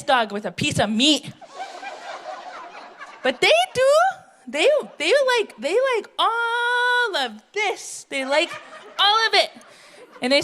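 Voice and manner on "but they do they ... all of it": high, drawn-out teasing brag; flirty boast. Acted. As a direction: show off your charms, coyly. Boasting men like her, high.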